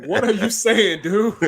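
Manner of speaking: high, loud, laughing mock question; laughing retort. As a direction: throw the retold comeback out through laughter. Retold comeback, amused by the story.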